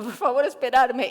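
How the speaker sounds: pleading call. Retold or imitated. Begging chimps to wait.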